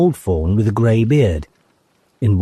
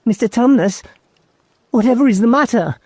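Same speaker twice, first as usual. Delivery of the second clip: put-on high, quick girl's concern; a girl alarmed at tears; worried Lucy; faster than usual. A narrator's reading.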